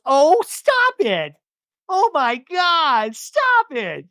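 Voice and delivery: high, drawn-out "stop it" gushing; mock bashful delight. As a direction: imitate a flattered woman protesting, stretching the words. [name] reacts to flattering picture.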